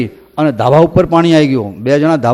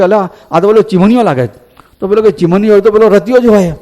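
higher, eager recognition; excited villagers; 6 semitones higher than usual. Retold or imitated. Villagers on the bridge recognising Chiman, higher and excited. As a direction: call out as you spot them. Villagers spotting friends on roof.

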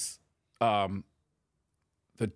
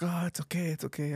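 forced calm; 10 semitones higher than usual. Retold or imitated. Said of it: "I have to remain calm".

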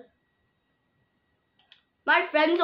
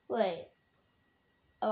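usual speaking voice

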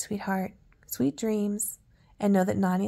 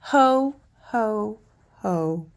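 booming, louder ho ho ho; jolly Santa laugh; 6 dB louder, much slower than usual. Acted. Santa laughing again in the workshop, booming jolly laugh. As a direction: let the big Santa laugh roll out. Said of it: "a mighty boom, a familiar and oh so jolly".

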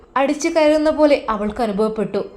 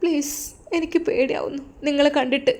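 put-on frightened young wife; 3 semitones higher, 5 dB quieter than usual. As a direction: plead softly, scared of him. Wife pleading.

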